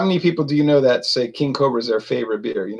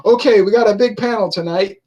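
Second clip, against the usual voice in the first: higher-pitched mock irritation; Eric getting angry; 5 semitones higher, 4 dB louder than usual. Host addressing a big panel, mock angry. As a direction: imitate a host getting worked up. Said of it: "Eric was getting so angry".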